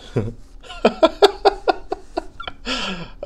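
long, high-pitched real laugh; helpless laughter. Real, not acted. Podcaster cracking up at his disco joke, helpless high laugh. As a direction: crack up at your own joke.

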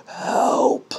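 airless, voiceless mock plea; out of breath. Voicing balls snuggled in sweatpants, airless plea. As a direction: squeeze it out with no breath. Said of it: "you're out of breath".

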